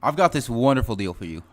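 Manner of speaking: loud sales pitch. Pretend. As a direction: raise your voice for a deaf customer. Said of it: "You're gonna have to speak up".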